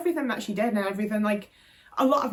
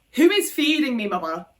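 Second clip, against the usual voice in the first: indignant retort; 3 semitones higher, 5 dB louder than usual. Real, not acted. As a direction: call her out pointedly. Rhetorical jab at mum.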